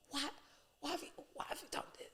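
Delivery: breathy, hurt plea, quick and soft; upset God. Retold or imitated. God grieving over David's deed, soft and hurt. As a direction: ask it like a wounded father. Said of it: "God was so upset 'cause he was like".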